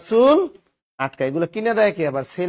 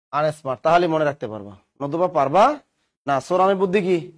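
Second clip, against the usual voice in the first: normal talking voice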